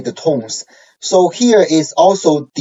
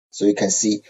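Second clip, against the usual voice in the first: ordinary delivery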